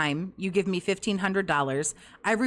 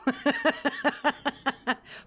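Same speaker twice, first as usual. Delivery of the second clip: long high cackling laugh; 5 semitones higher than usual. Real, not acted. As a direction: laugh it out in a drawn-out run. Scoffing at an easy search.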